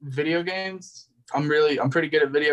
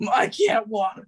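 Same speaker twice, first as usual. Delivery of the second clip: higher, whiny crying cartoon voice; mock crying; 5 semitones higher, slower than usual. Retelling the prison episode, a mock crying cartoon voice. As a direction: fake a blubbering breakdown in prison. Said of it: "he starts crying".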